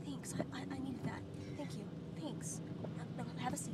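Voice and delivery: soft, grateful murmur; quiet thanks. Retold or imitated. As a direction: thank the child softly. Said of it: "I say to them quietly".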